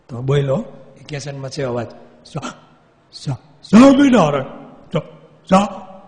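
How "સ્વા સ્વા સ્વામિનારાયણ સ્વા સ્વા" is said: jolting stutter on સ્વા-, bursting out Swaminarayan; jerky stammer. Retold or imitated. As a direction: jerk out the name. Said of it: "એવા ઝટકા મારે".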